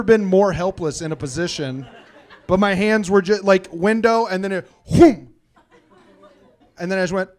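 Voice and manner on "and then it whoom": loud imitated slam; a loud whooshing slam noise; whoom slam. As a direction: mimic the window crashing down. Window slamming on his hands.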